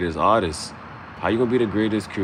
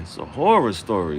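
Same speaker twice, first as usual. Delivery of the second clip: ordinary talk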